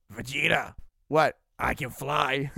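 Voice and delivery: low, dopey big-guy voice; a soft, dim-witted Nappa. Retold or imitated. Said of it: "Nappa, like, flying and stopping and be like".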